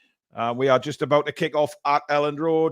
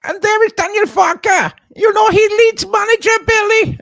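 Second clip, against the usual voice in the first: bad German accent; 10 dB louder than usual. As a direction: put on a terrible German accent. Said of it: "I don't know what accent that was ... supposed to be German".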